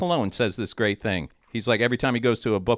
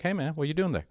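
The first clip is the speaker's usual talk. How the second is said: feigned casual; 3 semitones higher, 8 dB quieter, faster than usual. Retold or imitated. Comic on stage to man recording him, feigned casual. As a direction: ask softly, as if just curious.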